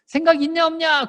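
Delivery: high, loud demanding yell; mom's shouting scold. Shown as a demonstration. Mother scolding child before test, loud high yell. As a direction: bark it at the kid the wrong way. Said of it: "그럼 엄마가 이렇게 얘기해야".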